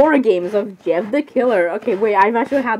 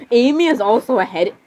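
ordinary talk, nothing marked

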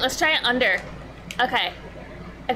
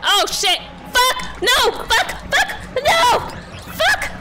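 panicked screaming. Real, not acted. Player chased by Zurks yells in real panic. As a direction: scream like the swarm is on you.

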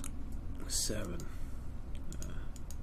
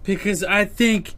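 furious yell, much louder; angry outburst; 2 semitones higher, 12 dB louder than usual. Real, not acted. Streamer yelling at the patient who won't die, furious. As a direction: explode at the screen in rage. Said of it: "I got a little upset there".

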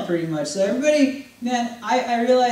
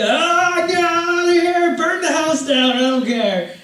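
higher, louder hammed-up disgust; mock disgusted yell; 7 semitones higher, 5 dB louder than usual. Preacher picturing worms to his listeners, loud mock disgust. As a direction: recoil from a tub of worms. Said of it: "I'd be".

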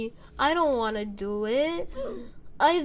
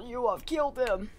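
usual speaking voice

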